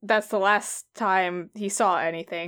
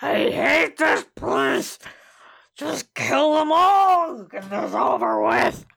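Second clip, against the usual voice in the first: angry villain shout; 4 semitones higher than usual. Acted. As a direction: bark the order in disgust. Villain orders puppet creature.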